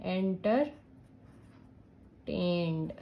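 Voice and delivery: "Entertained" very slowly as enter, long pause, tained; drawn out in parts. Shown as a demonstration. Teacher adding ED to a word, drawn out in parts. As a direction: break "Entertained" apart as if writing it out. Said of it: "अगर आपको ED add करनी है".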